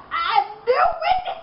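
giggling Joker. A narrator's reading. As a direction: cackle it with delight. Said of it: "he giggled".

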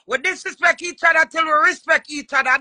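normal talking voice

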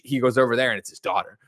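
ordinary delivery

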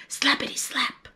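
soft whisper of wiper sounds. A narrator's reading. Narrator whispering the wiper sound. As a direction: breathe the slaps out unvoiced. Wiper noise told softly.

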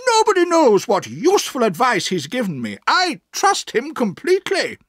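put-on high, emphatic old-aunt voice; aunt defending a friend. A narrator's reading. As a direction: insist in a high voice, building to a loud finish. Aunt defends Mister Dick.

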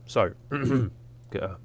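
normal talking voice

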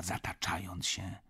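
ordinary delivery

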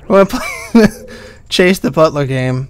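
giggling through the words, barely holding it together; laughing. Real, not acted. Streamer to viewers, announcing the next game through real giggles. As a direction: laugh helplessly while announcing. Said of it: "Don't ask me why I'm laughing".

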